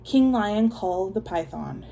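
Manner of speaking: ordinary delivery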